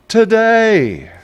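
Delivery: high, drawn-out sing-song Today; sing-song repeat. Shown as a demonstration.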